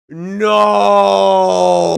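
loud anguished cry of no; a sobbing shout of no. Acted. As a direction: cry it out in despair. Breaks off in distress.

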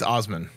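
"Osman" opening on a long Ah; Ah pronunciation. Shown as a demonstration. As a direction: say "Osman" with Ah as first vowel. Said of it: "So it's Ah".

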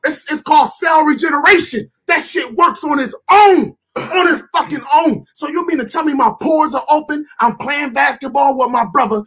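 impassioned rant. Real, not acted. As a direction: push it out hard, punching the key words. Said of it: "when a brother speak like this, he angry".

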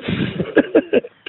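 wheezing giggle, up high; high breathy laugh. Real, not acted. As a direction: laugh at his stupidity. Laughing at the scammer.

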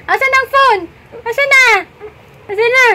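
high, loud whiny demand; voicing fussy baby. Mom imitating her baby's high whiny cry for the phone. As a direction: play the baby wailing for the phone.

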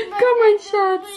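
laughing high with delight. Real, not acted. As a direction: laugh through the words. Found child, laughing.

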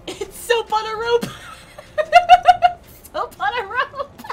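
high, laughing singsong exclamation; helpless high-pitched giggling between words; giddy laughing. Real, not acted. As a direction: squeal it high and crack up. Silly joke on character's name.

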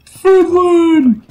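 very high, loud cartoon exclamation; high-pitched cartoon outburst; Patrick. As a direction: holler one word in a cartoon voice. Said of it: "Talk like Patrick".

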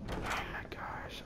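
hushed frightened whisper; soft whispered worry. Real, not acted. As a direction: whisper so nothing hears you. Hushed during scary game.

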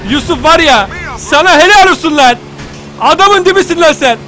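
loud, high happy praise; loud gushing praise for Yusuf; delighted cheer. Real, not acted. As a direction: cheer him on like a fan in the stands.

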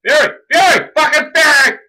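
very high, very loud shrieked shouts; frantic screaming. Real, not acted. Player calls Fury in a fight, frantic screaming. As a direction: scream it at full force.

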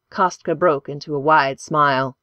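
ordinary delivery, nothing marked

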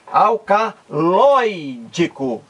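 "Alcaloídico" slowly, with LOI stretched and punched; stress on LOI. Shown as a demonstration. Teacher showing the strongest syllable, "Alcaloídico" with drawn-out, stressed LOI. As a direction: lean hard and long on the "loi" of "Alcaloídico". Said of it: "Loi é a sílaba mais forte".

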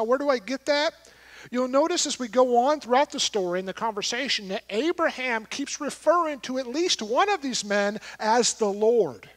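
ordinary talk

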